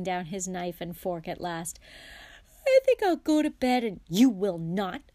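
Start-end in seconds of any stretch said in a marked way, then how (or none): 2.7-4.0 high, loud, yawn-stretched voice. A narrator's reading. Fred announces he is off to bed, yawning. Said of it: "yawned Fred". 4.1-5.0 loud, sharp refusal; snapping. A narrator's reading. Mother forbids her son to go to bed, snapping. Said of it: "snapped Missus Weasley".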